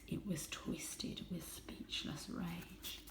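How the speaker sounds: soft, slow, unvoiced whisper; hushed. A narrator's reading. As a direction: let it fall to a tense whisper. Dramatic narration hushed.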